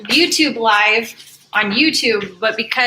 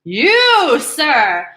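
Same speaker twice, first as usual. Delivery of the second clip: hammed-up haughty taunt; mock taunt; 3 semitones higher, 6 dB louder, slower than usual. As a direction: hurl it like a warrior. Character taunts fruit.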